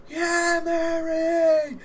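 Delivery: very high, loud, drawn-out yelling; cheering crowd. Acted. Crowd urging Mary on in the ring, screamed cheer. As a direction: holler it like a rowdy wrestling fan. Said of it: "The crowd is like".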